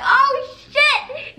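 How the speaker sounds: very high, loud mock yelp; squealed comic panic; panicked alarm. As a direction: re-enact getting caught red-handed. Retelling getting caught by the janitor.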